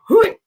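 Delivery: short, high yelp; sudden high "hooey", like a dog hearing a whistle; imitated dog perk-up. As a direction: pop out a high startled dog noise. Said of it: "you're like".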